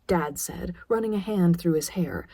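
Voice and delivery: usual speaking voice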